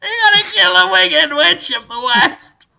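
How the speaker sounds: high, hammed-up wizard command; Willem Dafoe. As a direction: pronounce the quest as a giant head. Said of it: "So Willem Dafoe's big head says".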